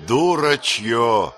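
slow, loud scornful word; contemptuous. A narrator's reading. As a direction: spit it out in disgust. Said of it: "повторил Энбо".

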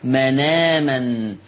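drawn-out Quranic recitation of مناما with the noon carrying fatha; slow demo reading. Shown as a demonstration. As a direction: recite مناما slowly with naql, noon opened. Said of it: "فيصير".

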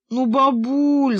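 high, slow drawn-out whine; boy whining at grandma, stretched out.

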